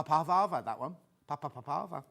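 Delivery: ordinary talk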